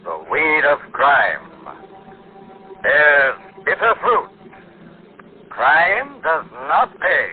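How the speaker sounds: ominous announcer. Acted. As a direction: intone it like a dark warning. Show's signature closing announcement.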